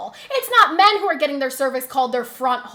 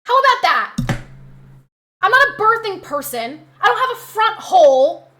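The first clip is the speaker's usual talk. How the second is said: angry outburst; 4 semitones higher, 7 dB louder than usual. Real, not acted. Angry rant continues.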